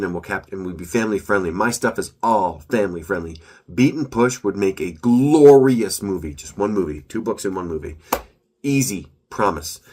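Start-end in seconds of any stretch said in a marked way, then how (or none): 3.7-6.3 slow, loud, proud pitch; excited. Real, not acted. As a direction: sell it with big enthusiasm. Enthusiastic pitch of books.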